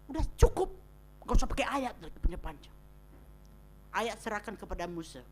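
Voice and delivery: ordinary talk, nothing marked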